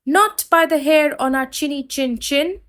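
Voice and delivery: pigs crying out. Acted. Three pigs crying out together at the wolf, high pitched. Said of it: "cried the three little pigs".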